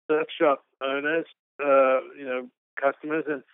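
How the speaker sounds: usual speaking voice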